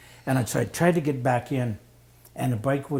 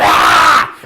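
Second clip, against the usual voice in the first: loud, sharp cry of the crash; retold scream; 17 dB louder than usual. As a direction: let out a sudden yell. Retelling the crash moment.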